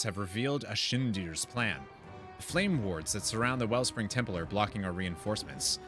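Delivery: ordinary delivery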